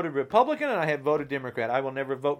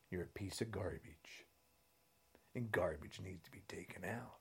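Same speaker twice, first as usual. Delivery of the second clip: whispered, softer threat; menacing whisper; 10 semitones lower, 20 dB quieter than usual. Real, not acted. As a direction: hiss the threat low.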